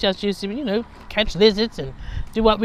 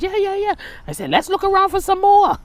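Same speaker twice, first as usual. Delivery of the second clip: much higher, eager and louder; excited child; 11 semitones higher, 3 dB louder than usual. Retold or imitated. Urging her cousin to dig more, high and eager. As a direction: egg the cousin on.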